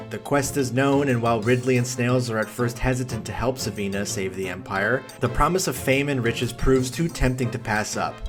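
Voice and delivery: ordinary talk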